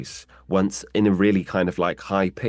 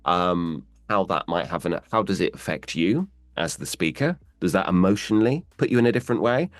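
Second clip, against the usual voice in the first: ordinary delivery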